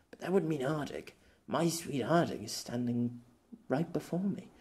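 soft, awed realisation; tender, hushed. Acted. Pierre realising Ardig stands before him, hushed. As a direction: say it in wonder.

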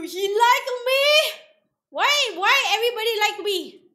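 high, loud excited wonder; excited outburst. Real, not acted. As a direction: exclaim it high at full volume. Excited at game characters liking them.

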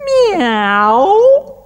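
cat meow. Acted. Storyteller voices the noisy cats on the ark, meowing high. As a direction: yowl like a cat making a row. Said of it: "They went".

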